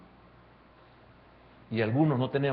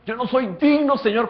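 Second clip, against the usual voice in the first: higher, louder pious plea; mock the overly humble prayer; 10 semitones higher, 8 dB louder than usual. Preacher imitating how believers pray, pleading. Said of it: "como algunas veces oramos".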